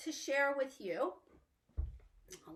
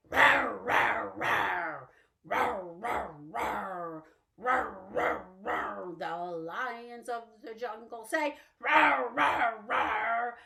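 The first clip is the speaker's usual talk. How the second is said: lion roar; slower than usual. Pretend. Teacher roaring like a lion in the song. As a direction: growl like a lion.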